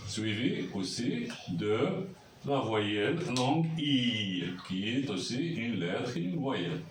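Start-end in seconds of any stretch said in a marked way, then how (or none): none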